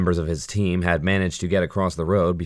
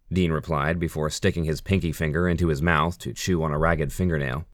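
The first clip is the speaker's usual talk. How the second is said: plain reading voice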